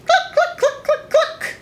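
high, quick clucking; a chicken clucking in the yard; hen clucks. Acted. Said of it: "When the chicken said".

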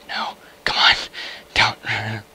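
ordinary delivery

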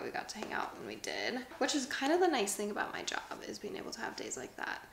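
soft unvoiced whisper, a bit fast. Real, not acted. Settling down to read, quick soft whisper. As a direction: whisper it so nobody wakes.

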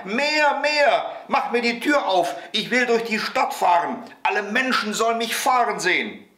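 put-on high, insistent child's yell; shrieked demands. A narrator's reading. Boy demands the moon open the door, high shriek. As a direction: squeal out orders like a little tyrant. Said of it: "schrie Häwelmann".

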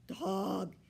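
loud, drawn-out cat howl; high, pleading yowl. A narrator's reading. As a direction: wail to be let out. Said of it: "Fiona howled".

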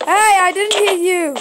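loud protest, slow and high; shout. Real, not acted. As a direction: yell it out in protest. Friends attacking him in game.

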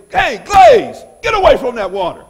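sudden high, very loud yell; coach's shout. Retold or imitated. Coach to player sneaking water at practice, shouting. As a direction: holler like a coach calling a player off.

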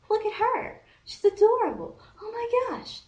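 very high, squealed admiration; high-pitched swooning excitement; smitten gushing. Retold or imitated. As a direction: gush over someone adorable. Acts out first seeing crush.

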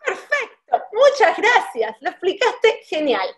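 warm thanks through a real laugh. Real, not acted. Host laughing as she thanks her guest, laughing.

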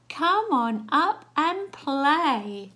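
loud, slow, high singsong invitation; inviting call. Acted. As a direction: call out playfully, stretching each word. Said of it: "They say".

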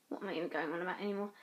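rapid exasperated mumble; fast. Real, not acted. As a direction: rush through it. Rambling self-mock.

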